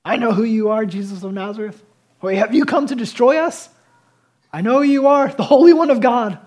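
loud, rising demonic cry, building to a yell; demon screaming. Retold or imitated. Possessed man's demon shrieking at Jesus, loud mock scream. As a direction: hurl the words at Jesus like a cornered demon. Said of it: "Demons start screaming from him".

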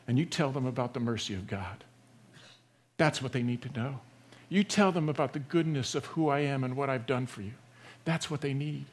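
quietly entrust them with a task; gentle instruction. Retold or imitated. Jesus to the healed demoniac, sending him home, gently.

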